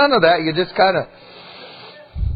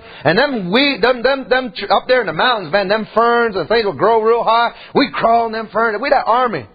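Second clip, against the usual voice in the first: ordinary talk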